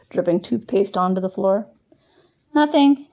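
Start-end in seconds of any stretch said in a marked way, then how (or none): 2.5-3.1 high squeaky nothing; squeak. Acted. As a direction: squeak it out nervously. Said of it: "Kevin squeaked".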